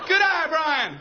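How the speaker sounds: high-pitched mock cheer; play-acted ballpark shout; calling out praise. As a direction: holler encouragement at a rattled batter. Teammate calling praise to batter.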